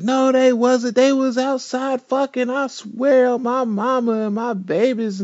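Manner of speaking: mock the liar doubling down; lying friend. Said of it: "Kayla's like".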